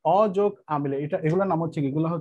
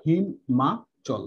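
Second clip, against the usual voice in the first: Himachal stretched very slowly; teacher drawing out Himachal; drawn-out answer; much slower than usual. Shown as a demonstration. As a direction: hold each syllable of Himachal for the class. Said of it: "অ যোগ আ সমান সমান কি হবে?".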